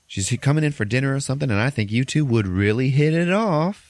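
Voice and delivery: high, eager secretary imitation; matchmaking secretary. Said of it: "And then she says".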